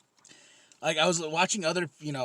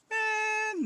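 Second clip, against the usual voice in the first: high exclaim. Retold or imitated. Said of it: "I was like".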